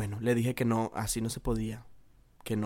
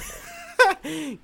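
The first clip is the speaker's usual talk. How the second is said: high burst of laughter; amused laugh. Real, not acted. Vlogger laughs at having cried over a crush, amused laugh. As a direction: laugh at your own silliness. Laughs at own crying story.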